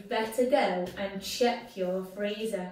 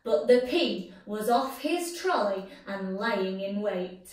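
ordinary delivery, nothing marked

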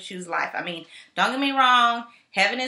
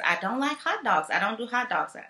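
ordinary talk

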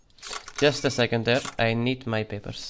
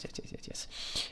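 whispered yeses; 15 dB quieter than usual. Real, not acted. Muttering over papers.

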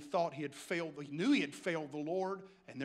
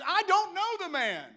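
high, loud, vehement denial; cursing, swearing denial; 10 semitones higher, 6 dB louder than usual. Acted. Peter curses and swears he doesn't know Jesus, vehement denial. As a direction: swear it forcefully, pitched right up. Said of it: "he begins to curse and swear".

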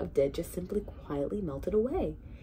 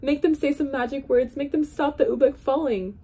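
higher, louder royal order; the king insists, pitched up and pushing; commanding king; 8 semitones higher, 7 dB louder than usual. Acted. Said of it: "he commanded".

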